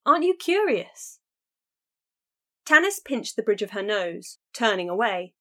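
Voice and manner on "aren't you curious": higher, louder pleading question; pleading, eager. A narrator's reading. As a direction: plead with excitement. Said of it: "Kiana cried".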